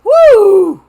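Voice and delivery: a big loud whoo, high and cathartic; whooping exhale of relief; loud release whoop. Real, not acted. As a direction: let the emotion blow out in one cry. Releasing wave of emotion.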